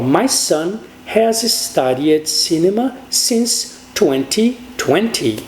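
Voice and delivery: very slow with pauses between word groups; slow model English. Shown as a demonstration. Teacher modelling the English sentence, slow and spaced. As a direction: dictate the sentence slowly.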